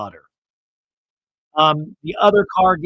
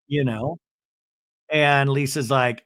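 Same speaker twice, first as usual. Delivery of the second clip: ordinary talk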